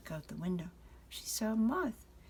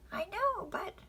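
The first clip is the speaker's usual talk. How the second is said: high, eager, cutting in; a child trying to interrupt; protesting little girl; 13 semitones higher than usual. Acted. Frances trying to interrupt Father's lecture.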